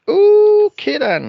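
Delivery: high, loud, slowly drawn-out okay then; sarcastic okay then. Retold or imitated. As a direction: drag it out in mock disbelief. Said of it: "And I'm like".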